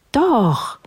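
high, insistent princess retort; calling princess. A narrator's reading. Princess to the unwilling dog, high insistent cry. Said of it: "rief die Prinzessin".

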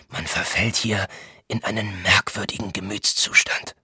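half-whispered, secretive remark; hushed confiding. A narrator's reading. Thilo contradicts Voynich over their months here, half whispering. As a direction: sink into a near whisper.

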